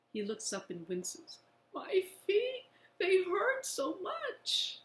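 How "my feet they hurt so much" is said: much higher, slow pained plea; aching boy's high slow plea; pained whimper. Acted. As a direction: let the pain pull the voice up. Boy with swollen sore feet.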